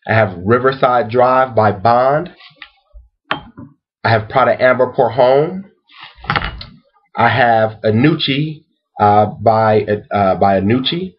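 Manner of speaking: slow list with rising endings; deliberate roll call with long pauses; slow repeat. Real, not acted. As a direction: space each name out, lifting at the end. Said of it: "let me repeat it slowly".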